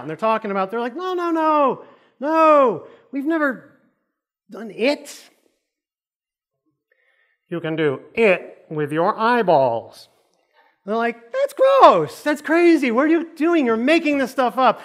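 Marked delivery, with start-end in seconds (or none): 1.0-5.3 high-pitched flustered denial; mock freak-out. Accused couple denying the affair, hammed-up flustered protest. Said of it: "now they're freaking out". 11.3-14.8 high, loud disgusted outburst; hammed-up freak-out. Couple reacting to the eyeball claim, hammed-up shrieky disgust. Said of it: "now they're freaking out".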